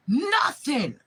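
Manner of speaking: high, loud shouted nothing; furious shout. Real, not acted. To the kidnapper family, one furious shout. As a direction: yell the word at them.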